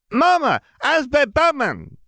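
put-on high, loud little boy; very high excited child; excited toddler. A narrator's reading. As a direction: shout it like a thrilled child. Ben excitedly calls.

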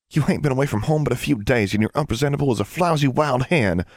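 high-pitched country sister scold; high, fussy telling-off. A narrator's reading. Older sister scolds the younger.

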